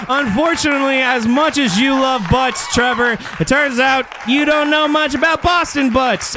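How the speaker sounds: hammed-up game show announcer; game show host. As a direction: announce it like a cheesy quiz host.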